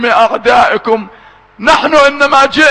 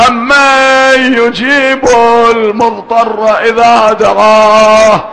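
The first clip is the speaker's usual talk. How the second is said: slow, drawn-out recitation; slow tajwid-style intoning; Quranic recitation; slower than usual. Acted. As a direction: intone the verse slowly as a supplication. Recites Quran verse in prayer.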